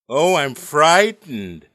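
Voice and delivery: loud, drawn-out fake fear; mock fright. Comic daring the audience to boo him, mock frightened. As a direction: sarcastically pretend to be scared, slow and loud. Mocks being scared of the audience.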